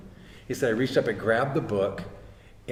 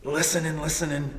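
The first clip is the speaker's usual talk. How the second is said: higher, held-up listening tone; expectant listening; 4 semitones higher than usual. Retold or imitated. Son listening for God's voice, expectant and higher-pitched. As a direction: act out waiting for an answer. Retold waiting for God's answer.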